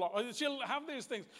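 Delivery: normal talking voice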